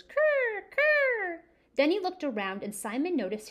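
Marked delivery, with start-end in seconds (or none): 0.0-1.4 high "Kerr, kerr" gull cries; gull calls. Acted. Seagulls answer Simon, high gull calls. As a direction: squawk like circling gulls. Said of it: "all they said was".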